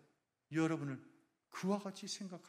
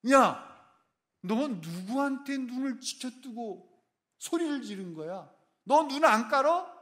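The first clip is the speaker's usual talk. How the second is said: high, loud yelling, words dragged out slowly; angry shouting rebuke; 9 semitones higher, 8 dB louder, slower than usual. Acted. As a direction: tear into him like an outraged father. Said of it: "사람의 음성처럼 듣는 거예요".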